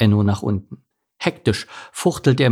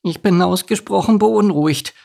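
high little duck voice; high, anxious young duck; worried duckling; 8 semitones higher than usual. Acted. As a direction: fret like a nervous duckling. Worried duckling Enno speaks.

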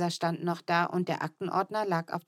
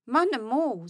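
put-on toddler; 6 semitones higher, 3 dB louder than usual. A narrator's reading. Kleiner Mo grüßt Manne.

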